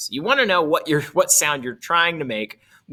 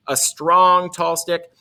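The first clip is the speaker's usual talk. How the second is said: strong hit louder, tall stick held; stress on strong; 4 semitones higher, 6 dB louder, slower than usual. Shown as a demonstration. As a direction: punch strong like an accent. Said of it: "look how different these are".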